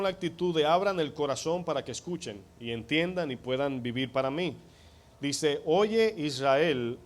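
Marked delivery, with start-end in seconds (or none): 5.6-7.0 slow, drawn-out solemn call; solemn scripture call. Acted. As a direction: stretch Oye Israel out like a proclamation. Quoting Shema solemnly. ("Oye Israel" lento y solemne, como proclamación)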